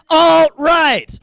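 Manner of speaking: high, loud shouted punchline; triumphant shouted answer. Acted. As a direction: blast the punchline.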